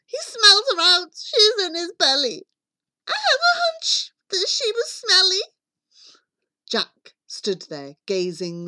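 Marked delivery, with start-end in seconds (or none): 0.0-5.4 put-on high, loud wailing boy; boy crying out. A narrator's reading. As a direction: wail the shocking news as young Jack. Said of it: "Jack cried".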